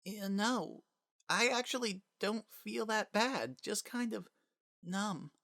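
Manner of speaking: put-on a small boy, dazed and faint; quietly numb little boy; high, soft child voice, numb. Dying child says he's numb.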